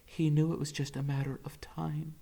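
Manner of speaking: ordinary delivery, nothing marked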